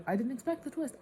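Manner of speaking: surprised admission. Real, not acted. Reader reacting to the book's twist, surprised. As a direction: blurt it out, high and loud.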